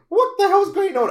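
high, loud disbelieving outburst; baffled exclamation. Retold or imitated. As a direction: act out the confusion, loud and high. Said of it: "I'm like".

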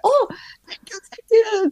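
high, stretched-out grateful "Thank you"; overjoyed thanks, stretched slowly; gushing thanks. Retold or imitated. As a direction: draw out a delighted thank-you. Hotel guest thanking helper gushingly.

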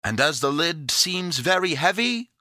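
put-on high, loud, eager boy; crying out. A narrator's reading. Epimetheus offers Pandora help with the lid, crying out. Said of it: "cried Epimetheus".

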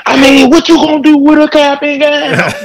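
squeaky high mock of the friend. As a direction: pitch it way up to mock him. Mocking the friend's question.

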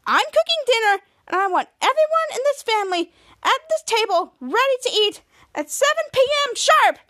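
angry. A narrator's reading. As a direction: lay down the law, rising in pitch as you go. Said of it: "so angry".

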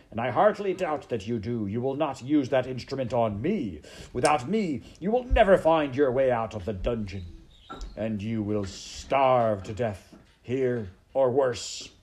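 loud rapid warning slowing to menace; threatening rat. Acted. Rat warning the maid she'll starve, loud menace.

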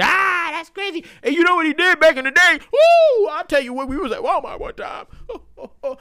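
1 mocking gossip voice. Voicing laughing new friends.

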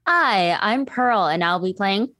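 ordinary talk, nothing marked

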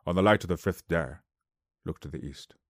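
a hint of a solemn old wizard; better Gandalf impression. Shown as a demonstration. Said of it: "I can do that better".

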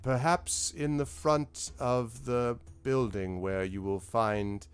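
very slow, drawn-out lordly speech; measured lord, sceptical. Acted. Lord steers the boaster toward the guards, slow and deliberate. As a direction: choose each word slowly, politely sending him away. Game master voices Lord Roaringhorn.

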